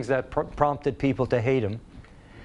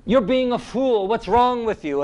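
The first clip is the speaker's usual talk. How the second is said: scornful crowd; 6 semitones higher, 3 dB louder than usual. A narrator's reading. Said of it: "And the people said".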